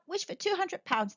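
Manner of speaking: high, lively suggestion; breezy urging. A narrator's reading. Son urging his father to wish, breezily. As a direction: toss it out lightly.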